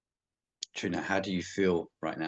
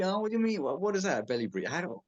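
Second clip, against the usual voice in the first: confused beginner; 10 semitones higher than usual. Retold or imitated. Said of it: "'cause a lot of people get confused".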